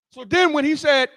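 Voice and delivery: ordinary talk, nothing marked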